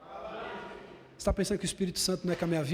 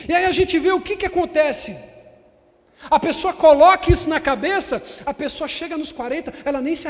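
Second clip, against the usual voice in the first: loud, high preaching build; preaching at full force; 7 semitones higher, 10 dB louder than usual. Acted. Pastor preaching loud to the church, full force. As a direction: preach it out to the congregation.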